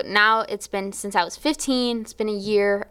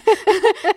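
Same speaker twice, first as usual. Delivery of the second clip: laugh; 12 semitones higher, 5 dB louder than usual. Real, not acted. Laughing at her own story.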